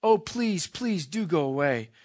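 high, loud frightened pleading, short of a scream; near scream. Acted. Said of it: "almost in a scream".

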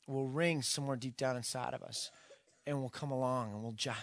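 ordinary talk, nothing marked

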